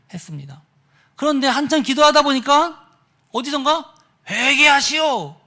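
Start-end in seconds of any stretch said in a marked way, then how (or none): 4.3-5.4 slow, loud drawn-out call; voice from somewhere. Retold or imitated. Unseen voice reaches a praying youth, calling out slowly. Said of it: "이런 음성이 들리더라는 겁니다".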